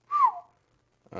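a high excited whew; high whistling whew. Real, not acted. Reacting to a close guess.

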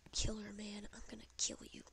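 soft unvoiced menace; whispered threat. Real, not acted. Gamer hunting his killer, whispered menace. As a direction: breathe the threat under your breath.

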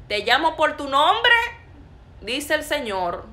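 loud, high public proclamation; prophetic proclamation. Retold or imitated. Preacher relaying God's word to an unnamed person, loud proclamation. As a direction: call it out to the whole church. Re-enacts announcing prophecy to congregation.

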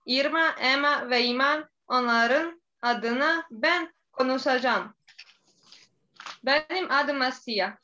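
Bosnian accent in Turkish; careful learner's Turkish with a Bosnian accent; foreigner broken turkish. Real, not acted. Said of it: "Üç yıldır Türkçe öğreniyorum".

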